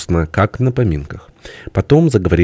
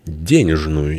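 usual speaking voice

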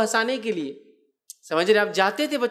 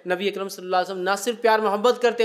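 normal talking voice

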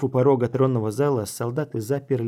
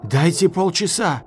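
loud, high bargaining call; shouted request; 5 semitones higher, 6 dB louder than usual. A narrator's reading. Silk bargaining with the officer, shouting. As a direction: call out for more time. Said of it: "крикнул Шёлк".

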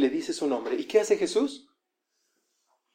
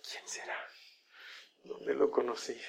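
very soft, puzzled inner voice; pensamiento para sí; 3 semitones lower, 15 dB quieter than usual. Acted. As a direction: wonder privately, almost inaudibly. (pensando en voz muy baja)

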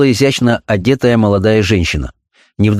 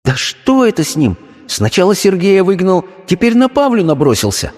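wife's irritated thought; 10 semitones higher than usual. Acted.

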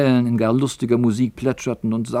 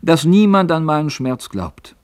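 put-on young sailor lamenting; 8 semitones higher, 3 dB louder than usual. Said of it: "»Ach«, erwiderte Elis".

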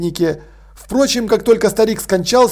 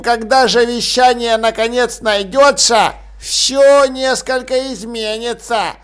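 put-on gloating old man; 3 semitones higher, slower than usual. A narrator's reading.

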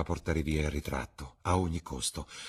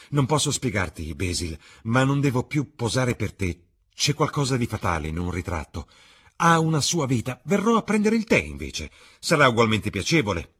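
higher, louder youthful voice pressing his friend; young Dorian, eager; 6 semitones higher, 8 dB louder than usual. A narrator's reading. Dorian reassuring Basil, higher and louder. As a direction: Dorian brushing off talk of adoration, bright and insistent.

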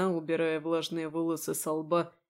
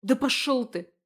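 high, loud snapped retort; girl snapping at the man advancing on her; snapping back; 7 semitones higher, 6 dB louder than usual. Acted. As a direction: bite back at him rudely. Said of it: "огрызнулась она".

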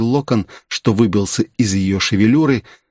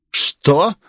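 higher, outraged outburst; angry shock; 8 semitones higher than usual. A narrator's reading. Mistress hearing of a killing, angry shock. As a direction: mistress stunned and angry at late news. Hears of killing, then slaps him.

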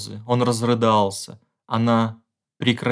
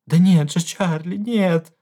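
very high, pleading cries; the wife insists to her husband; desperate denial; 8 semitones higher, 3 dB louder than usual. A narrator's reading. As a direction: protest through tears. Wife denying, upset.

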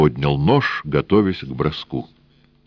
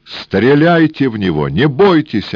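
high, loud desperate cry; desperate shout; 9 semitones higher, 6 dB louder than usual. A narrator's reading. Pinned engineer begs Death to shoot, desperate shout. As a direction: yell in despair while pinned. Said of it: "отчаянно крикнул Эраст Петрович".

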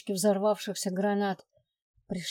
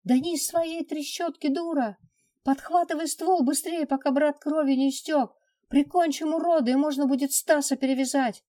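high, loud frantic yelling; frantic shouting; 7 semitones higher, 5 dB louder than usual. Acted. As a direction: yell orders in a panic. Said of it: "услышал я голос кузена".

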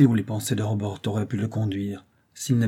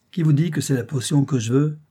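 raised, impatient snap at his mother; impatient outburst; 5 semitones higher, 5 dB louder than usual. A narrator's reading. Dying son snaps impatiently at his mother, raised. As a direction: cut her off, fed up. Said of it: "s'écria Marx avec impatience".

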